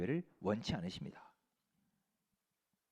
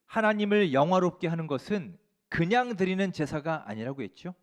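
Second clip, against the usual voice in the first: ordinary talk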